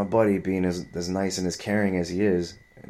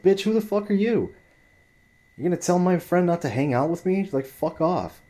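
high-pitched, incredulous outrage; indignant comeback; 10 semitones higher, 3 dB louder than usual. Retold or imitated. Storyteller replaying his retort to a woman meddling with his friend, indignant. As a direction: fire back as if personally affronted.

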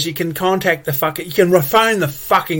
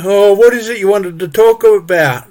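prissy higher mock voice, a bit louder; lightly hammed-up officious clerk; mock council staffer; 5 semitones higher, 5 dB louder than usual. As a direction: ape the gatekeeping phone clerk. Mimicking council phone staff.

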